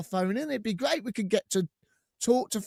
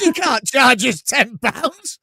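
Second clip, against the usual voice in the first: slow, louder, hammed-up protest; mock indignant protest; 5 semitones higher, 6 dB louder, much slower than usual. Co-host retelling arguing with hotel staff, slow and louder. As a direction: lean on it like a cheeky complaint.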